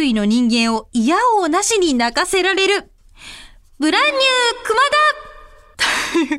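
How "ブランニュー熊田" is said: hyped title call. Acted. Reading title of listener idea.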